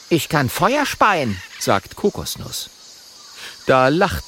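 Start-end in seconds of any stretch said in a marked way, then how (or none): 0.1-1.4 high, slow little dragon boast; high-pitched, deliberate boast. Acted. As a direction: announce it as the small dragon.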